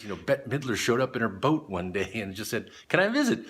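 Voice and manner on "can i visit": asking sweetly. Retold or imitated. Diver mimicking [name] asking to visit the site, high voice. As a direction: chirp it up high like a celebrity guest.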